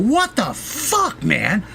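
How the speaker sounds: high, slow, outraged cry; outraged yell. Retold or imitated. [name] kicking the trailer door open at Tommy, outraged. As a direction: stretch it out in disbelief. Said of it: "I stood up ... I went".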